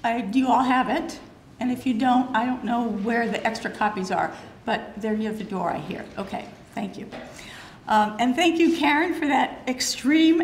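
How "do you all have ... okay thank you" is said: ordinary talk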